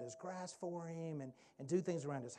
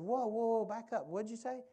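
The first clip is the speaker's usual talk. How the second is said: surprised; 7 semitones higher, 6 dB louder than usual. Retold or imitated. Stunned reaction to Alan's news.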